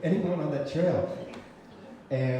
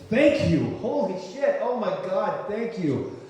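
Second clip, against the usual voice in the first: higher, gushing thanks; exclaimed gratitude, pitched up; elated relief; 6 semitones higher, 3 dB louder than usual. Retold or imitated. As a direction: burst out with overwhelming relief. Silent elated relief, acted out.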